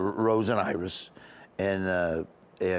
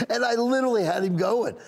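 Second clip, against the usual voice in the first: normal talking voice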